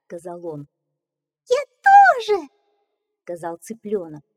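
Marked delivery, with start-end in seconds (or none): 1.5-2.5 tiny squeaky chick, much higher. Acted. Chick voice in read fairy tale.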